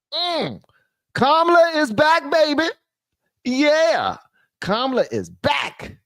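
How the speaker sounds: high excited hype voice; high-pitched hype. Real, not acted. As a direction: announce her return with high glee. Hyping Kamala's return.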